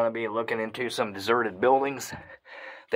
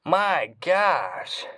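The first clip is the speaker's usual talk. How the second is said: excited awe; 4 semitones higher than usual. Real, not acted. Awed by huge cave.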